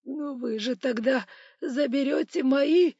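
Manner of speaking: high, slow, loud whine; wailing, plaintive objection; tearful protest. A narrator's reading. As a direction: object plaintively near tears. Tearful victim protests to robber.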